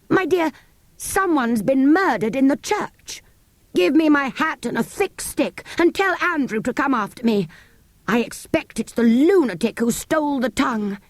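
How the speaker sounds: high, agitated vicar; alarmed vicar. Acted. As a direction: clergyman in a fluster.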